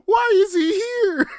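very high, cracked laughing wail; laughing in shocked disbelief. Real, not acted. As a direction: cry it out through laughter.

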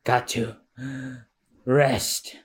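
acted near-collapse, halting; weak and wounded. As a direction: let the strength drain out.

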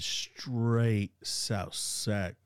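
drawn-out South Sacramento drawl, very slow; South Sacramento accent. Retold or imitated. Actor showing his friend how he sounded off script, thick South Sacramento accent. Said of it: "can't even get back to that accent".

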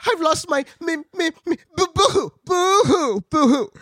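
high, loud hammed-up boo-hoo sobbing; mock crying kangaroo. Kangaroo sobs to Dorothy about lost mittens, hammed-up high wail.

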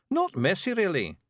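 high-pitched Scots reassurance; Scottish Feegle, reassuring. A narrator's reading. Voices Rob, a Feegle.

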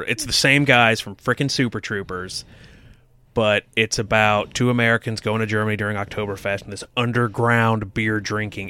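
ordinary talk

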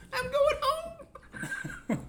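very high, very slow squeaky mock whine; laughing high-pitched mock exit; mock huff. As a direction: stretch it out in a silly high voice.

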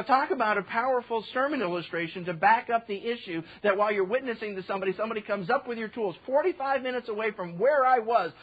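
normal talking voice

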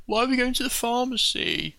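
put-on child's rising alarm; anxious child. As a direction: ask it like a nervous kid.

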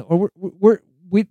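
usual speaking voice

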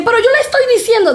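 high, rushed protest; imitated protesting teacher. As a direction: mimic the teacher butting in, high and fast. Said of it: "Ella dice".

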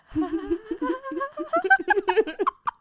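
high-pitched burst of laughter; laughing at the vampire pun. Real, not acted. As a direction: crack up at your own joke.

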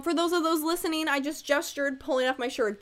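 normal talking voice

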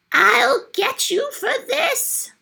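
high, slow raging yell; angry shout. A narrator's reading. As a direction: scream the threat after her.

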